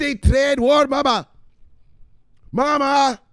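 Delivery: high, loud, exasperated son voice; son yelling at mama. Pretend. Son shouting crypto news back at his mama, high and exasperated. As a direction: wave her off like a dramatic grown son.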